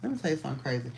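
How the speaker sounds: ordinary delivery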